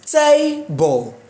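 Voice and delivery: TABLE with TA high, BLE dropping away; TABLE, first syllable lifted and held; stress on first syllable. Shown as a demonstration. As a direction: punch the TA of TABLE, let BLE fall. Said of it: "The stress is on te".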